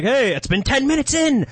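very high, hammed-up furious yelling; arguing wrestlers. Wrestler still swearing at a rival ten minutes in, mock argument. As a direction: squawk it out like a mock ringside argument. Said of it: "they just get into big arguments".

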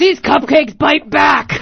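loud, slow booming shout; monster truck announcer. Acted. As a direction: roar it like an arena ad.

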